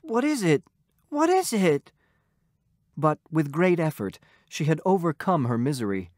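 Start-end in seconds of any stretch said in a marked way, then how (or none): 0.0-0.6 high, anxious question; worried mumble. A narrator's reading. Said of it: "He mumbled". 1.1-1.9 higher, pleading repeat; more anxious repeat. A narrator's reading. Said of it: "He mumbled".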